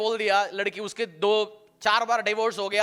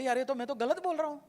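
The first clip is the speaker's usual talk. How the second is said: quiet self-correction; 5 semitones higher, 6 dB quieter, faster than usual. Retold or imitated. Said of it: "बोले".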